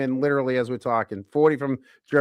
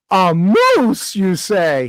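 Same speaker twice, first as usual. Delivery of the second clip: raised, incredulous Irish voice; hammed-up Irishman, punching the first words; badly faked Irish accent, amazed; 4 semitones higher, 5 dB louder, much slower than usual. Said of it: "And the Irishman goes".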